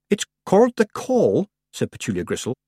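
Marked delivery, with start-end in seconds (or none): 0.1-1.5 put-on high, hesitant, slow girl; timid girl voice. A narrator's reading. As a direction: shyly explain it.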